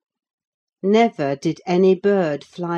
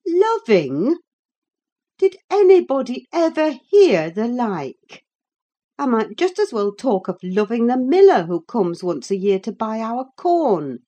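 raised, mocking indignation; scornful; 6 semitones higher, 5 dB louder than usual. A narrator's reading. Ellen ridiculing the girl's talk of loving, scornful. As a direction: pitch it up in disbelieving scorn. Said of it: "as scornfully as I could".

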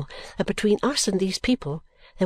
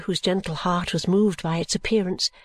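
ordinary delivery

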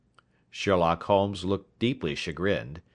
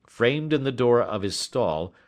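usual speaking voice

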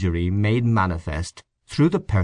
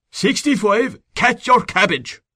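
put-on much higher, louder, slow shouted order in a thick country Irish accent; thick provincial command; 13 semitones higher, 4 dB louder, slower than usual. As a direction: yell it like a country sergeant at the depot, Irish brogue. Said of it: "He assumed a thick provincial accent".

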